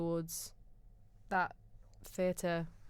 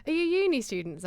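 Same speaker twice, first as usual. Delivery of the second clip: higher, louder chatty delivery man; 10 semitones higher, 6 dB louder than usual. Retold or imitated. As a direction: lightly voice the curious delivery man. Retold chat with delivery man.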